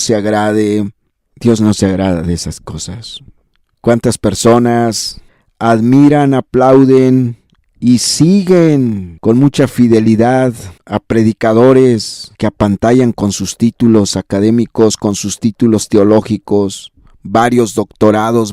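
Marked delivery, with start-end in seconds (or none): none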